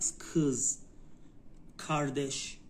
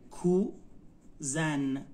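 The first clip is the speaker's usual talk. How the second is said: کوزن very slowly as کو, pause, زن; slow teaching demo of کوزن, split in two; syllable by syllable; much slower than usual. Shown as a demonstration. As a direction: sound out کوزن in two stretched parts. Teacher breaks word into syllables.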